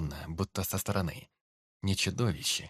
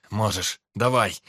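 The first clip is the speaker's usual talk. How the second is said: urgent shout; 8 dB louder than usual. Acted. Urging brother into pit.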